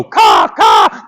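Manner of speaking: loud imitated crow caws. Storyteller imitates the crow Boots expects to hear, cawing. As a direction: squawk like a crow. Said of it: "crow might just go".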